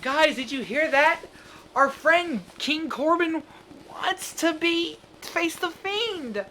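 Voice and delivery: put-on high, slow, eerie children's host; sing-song creepy host voice, drawn out; Bray Wyatt voice. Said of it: "Bray Wyatt's like".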